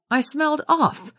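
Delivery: lightly contrived outrage; objecting. A narrator's reading. Jinx objects to Wilf's remark, mock outraged. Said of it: "I objected".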